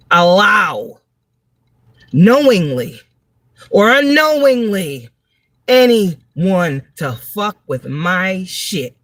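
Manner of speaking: each word spaced out with long pauses, the last line very slow; a slow, deliberate vow; 1 slow emphatic vow. Real, not acted. Vlogger swears to viewers nobody messes with her again, slow and deliberate. Vowing never to allow it.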